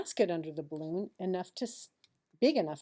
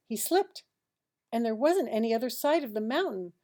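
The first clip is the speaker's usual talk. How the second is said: much higher and louder, fast; dramatic alarm; 5 semitones higher, 8 dB louder than usual. A narrator's reading. Narrator exclaiming to the child as Harold falls. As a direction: cry out the fall.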